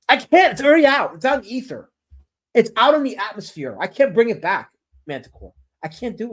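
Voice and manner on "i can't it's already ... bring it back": high, loud protest; emphatic protest. Real, not acted. Streamer tells a chatter it's too late, high emphatic protest. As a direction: plead loudly that it can't be undone.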